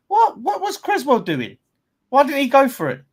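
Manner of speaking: high, loud outraged yell at the players; yelling from the sidelines. Real, not acted. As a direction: shout at the screen in disbelief. Fan yelling at the match.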